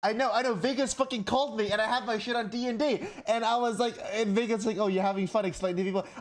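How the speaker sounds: high, loud, rushing agitation; flustered, high-pitched and loud; worked-up lament. Real, not acted. Frantic recounting of a missed call.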